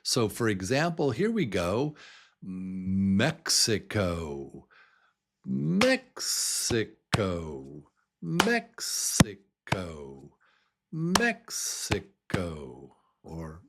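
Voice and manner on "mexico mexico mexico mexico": Mexico slowly, MEX punched; stress on first syllable. Shown as a demonstration. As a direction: break Mexico into beats, strong MEX first. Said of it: "What is the stressed syllable".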